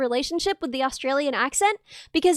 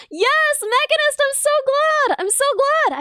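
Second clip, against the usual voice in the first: high, loud happy gush; excited squeal; 9 semitones higher, 3 dB louder, slower than usual. Real, not acted. Streamer squealing happily to a chatter named Mechanist. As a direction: burst with joy for them.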